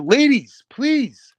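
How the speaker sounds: high exasperated shush; exasperated plea. Retold or imitated. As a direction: beg them to stop, exasperated. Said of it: "I was like".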